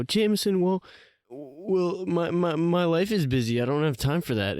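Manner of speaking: high, whiny mocking excuse. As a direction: mimic a listener making excuses. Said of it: "and then you go".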